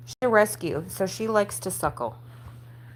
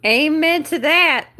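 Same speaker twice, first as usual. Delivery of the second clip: loud exclamation; 9 semitones higher, 8 dB louder than usual. Real, not acted.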